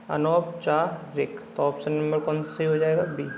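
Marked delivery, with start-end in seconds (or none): 0.1-1.4 अनौपचारिक very slow, pausing between parts; slow syllable breakdown. Shown as a demonstration. Teacher dictates the spelling to students, very slowly. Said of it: "ध्यान रखना double मात्रा आती है".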